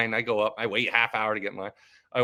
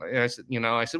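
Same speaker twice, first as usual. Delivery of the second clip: normal talking voice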